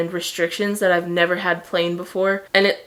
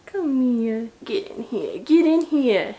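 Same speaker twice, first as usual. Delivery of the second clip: overwhelmed by cuteness; 3 semitones higher, slower than usual. Real, not acted. Coaxing pet.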